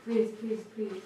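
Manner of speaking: soft, desperate pleading; real tearful prayer; emotionally overwhelmed. Real, not acted. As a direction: beg under your breath, close to tears. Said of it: "This is so emotionally hard".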